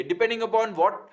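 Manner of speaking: usual speaking voice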